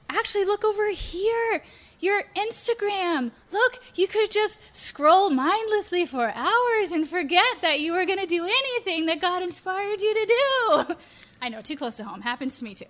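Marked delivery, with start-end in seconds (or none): 0.1-11.0 higher, sly coaxing tempter; tempter's voice. Acted. Tempter luring a believer to scroll Instagram, higher and sly. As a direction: lure them toward endless scrolling. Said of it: "the tempter says".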